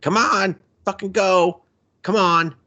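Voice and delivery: high, impatient holler; impatient urging. Retold or imitated. Amir outside honking the horn, impatient urging. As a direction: hurry someone along, pitched well up.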